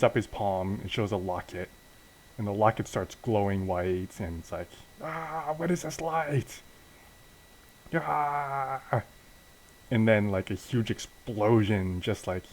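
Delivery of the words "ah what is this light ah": high, strained mock scream; acted wail of alarm, held back in volume; alarmed cry. Villain blinded by glowing locket.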